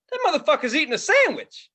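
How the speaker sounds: high, loud-ish mock disbelief; incredulous callout. Comic onstage spots a man eating a sandwich, mock incredulous callout. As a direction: imitate a comic calling someone out in amazement.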